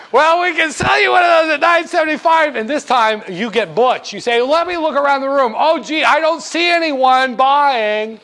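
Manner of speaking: a hint of a gossipy old clerk teasing the buyer; Mabel the treasury clerk. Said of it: "I'm Mabel".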